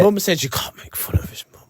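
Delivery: hushed high whisper. Real, not acted. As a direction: whisper it like a secret.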